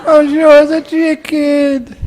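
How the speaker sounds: high-pitched tearful wail; hammed-up blubbering; mock crying. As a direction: insist through pretend sobs. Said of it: "that's when she started to cry".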